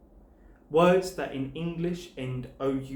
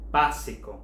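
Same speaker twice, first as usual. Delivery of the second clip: básico with the beat on BÁ, the last two syllables light; shifted stress; 3 semitones lower, 4 dB louder than usual. Shown as a demonstration. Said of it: "there's a shift in stress here".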